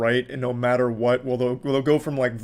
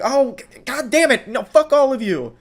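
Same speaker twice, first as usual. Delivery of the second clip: higher, louder mock furious outburst; mock fury; 11 semitones higher, 5 dB louder than usual. Commentator imitating the woman yelling back at the crowd. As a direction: sputter and snap like the angry woman. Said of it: "then she's like".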